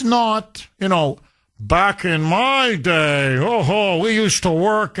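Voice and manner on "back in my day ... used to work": mock old-timer reminiscing, drawn-out slow; middle-aged fogey. Middle-aged fogey recalling his working days, mock nostalgic. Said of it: "this is not some middle-aged fogey".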